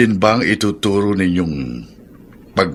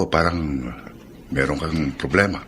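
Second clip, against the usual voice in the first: softer and lower, tender probing; gentle concern; 6 semitones lower, 7 dB quieter than usual. Acted. Son softly asking his sad father, tender. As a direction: ease into it gently.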